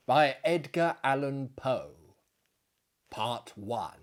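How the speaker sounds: ordinary delivery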